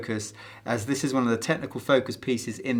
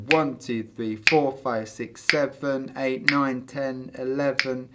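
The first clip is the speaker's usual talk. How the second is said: "seven" and "eleven" drawn out, slow; counting with full syllables that lose the pulse; overlong count; slower than usual. Shown as a demonstration. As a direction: drag the long numbers and fall behind. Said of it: "so you lose it".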